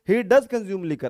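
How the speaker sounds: does punched slow and loud; stress on does. Shown as a demonstration. Teacher showing emphasis with does. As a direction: lean hard on does. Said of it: "pressure डाल के बोलने के लिए".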